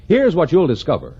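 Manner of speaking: ordinary talk, nothing marked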